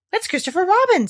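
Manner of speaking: high, eager exclamation; bursting with welcome. Acted. Pooh spots Christopher Robin, eager. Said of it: "said Pooh eagerly".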